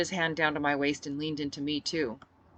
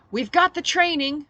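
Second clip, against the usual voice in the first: rousing speech; 11 semitones higher, 9 dB louder than usual. Acted. Speech over cheering crowd.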